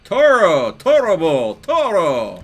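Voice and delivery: high, loud "Toro!" shouts; bullfighter cry. Real, not acted. Player taunts the charging hog, loud matador-style shouts. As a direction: call out like a matador taunting the bull.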